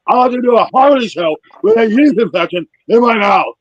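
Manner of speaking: loud, high mock swollen-tongue whining. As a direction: complain to the wife through a fat tongue. Swollen-tongue complaint to wife.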